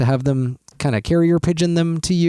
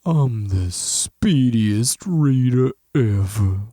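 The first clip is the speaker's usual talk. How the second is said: Droopy Dog voice; 3 semitones lower, much slower than usual. Pretend. Imitating Droopy Dog after description.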